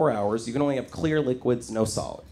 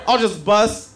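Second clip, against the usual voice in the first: ordinary delivery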